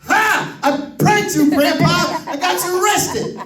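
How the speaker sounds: put-on three-year-old grandson gloating at grandpa; gleeful toddler. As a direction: crow it like a delighted little kid.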